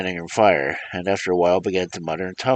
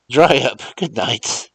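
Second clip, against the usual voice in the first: high and loud, brusque and irritated; impatient Mars John; 6 semitones higher, 6 dB louder, slower than usual. Acted. Mars John scolds Remus down the phone, impatient. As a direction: snap at the old man to hurry.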